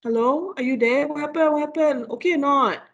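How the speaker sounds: high, worried calling out to her husband; anxious wife calling out. Retold or imitated. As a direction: call out nervously into the silence. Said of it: "She was like".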